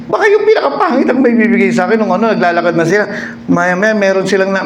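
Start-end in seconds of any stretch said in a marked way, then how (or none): 0.1-2.0 scared man. Acted. Third man frightened.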